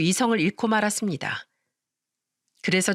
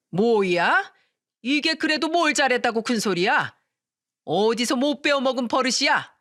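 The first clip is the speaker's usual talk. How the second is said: higher, sharper yelling back; furious retort; 4 semitones higher than usual. Acted. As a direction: bark back in outrage. Mother-in-law yells back in fight.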